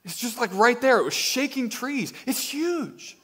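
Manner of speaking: imitated woman marvelling at the huge bear; amazed woman. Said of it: "She says".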